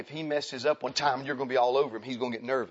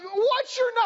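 much higher, louder frantic question; frantic parent; 6 dB louder, slower than usual. Retold or imitated. Parent testing a frightened child, high loud frantic. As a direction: quiz the child in a shrill frantic voice. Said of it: "I mean".

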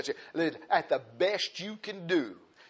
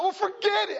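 high-pitched acted outburst; mock cry of frustration; exasperated giving up. Worn-down man gives up.

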